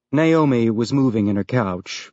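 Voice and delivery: plain reading voice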